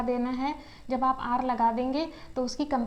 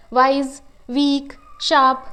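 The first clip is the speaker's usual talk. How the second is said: Wise, वीक, sharp each said louder as one single beat; 6 dB louder, slower than usual. Shown as a demonstration. Teacher showing that monosyllables come out in one beat, louder. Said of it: "इसमें हम कहीं भी नहीं रुके".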